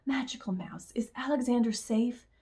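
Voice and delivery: softened, mildly anxious question; hushed, understated tremble; trembling voice. A narrator's reading. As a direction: ask softly with a touch of worry. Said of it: "The old lady's voice trembled".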